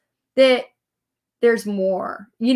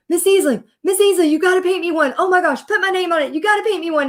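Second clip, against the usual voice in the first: put-on high, excited, pleading kid voices; eager kids; 7 semitones higher, faster than usual. Kids begging their teacher for a board, high and eager. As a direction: beg like a thrilled child.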